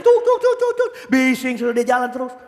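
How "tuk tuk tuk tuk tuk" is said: rapid high-pitched machine chugging; imitated factory din. Preacher showing how noisy the factory is, imitating it. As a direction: mimic noisy machinery with your voice. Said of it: "itu pabrik bising".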